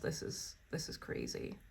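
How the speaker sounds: breathless awed whisper. Real, not acted. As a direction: hush it out in disbelief. Awed whisper over the card.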